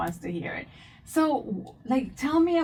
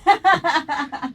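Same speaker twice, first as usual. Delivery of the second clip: laughing, higher pitch; 7 semitones higher, 7 dB louder than usual. Real, not acted. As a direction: laugh through the word. Host laughs at her mistake.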